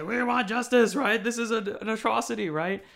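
mock protester calling for justice; protest shout. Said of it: "we say".

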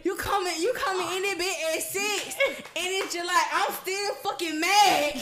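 mock-angry laughing rant about partner; laughing mock anger. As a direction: rant it loud while cracking up. Retelling anger, laughing, louder.

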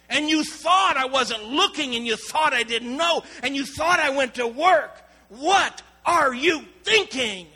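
loud, slow yell at a disobedient daughter; furious father yelling. Retold or imitated. Father catching his daughter at the park, furious yell. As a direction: roar at her, scared and angry. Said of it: "screams in her face".

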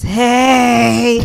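ordinary delivery

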